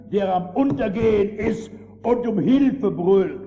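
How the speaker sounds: fervent sermon build; loud preaching. Acted. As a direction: swell the voice toward the cry for help. Preacher paints a drowning man.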